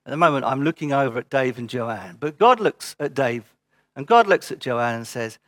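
normal talking voice

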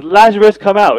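shouted command, high and loud; loud call. Acted. Preacher voicing Jesus at the tomb, commanding shout. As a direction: call out with full authority.

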